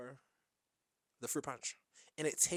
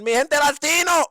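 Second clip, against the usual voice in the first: loud, high call to the crowd; rallying shout; 12 semitones higher, 16 dB louder than usual. Retold or imitated. Host hyping up Latin people, shouting. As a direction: shout it out like a hype man.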